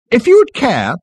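high, loud strident voice; strident girl. A narrator's reading. Girl addresses Ford over agenda, stridently. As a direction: cut in stridently. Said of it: "the girl with the strident voice".

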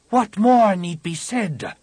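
high, slow, loud scorn; scornful shaper. A narrator's reading. Shaper sneering at the Jedi's ally, high and slow.

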